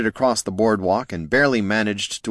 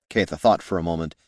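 ordinary delivery, nothing marked

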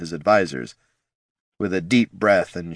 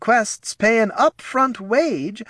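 nervous advisor voice; 13 semitones higher than usual. Acted. Reads Ortson's dialogue.

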